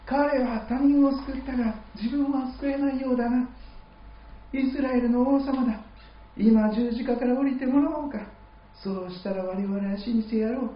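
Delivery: high, haughty mocking voice of the scoffers; mocking lordly sneer. Preacher voicing the mocking elders at the cross, high, haughty sneer. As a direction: lord it over him with a sneer. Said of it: "まるで殿様のような形で".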